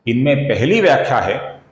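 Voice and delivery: normal talking voice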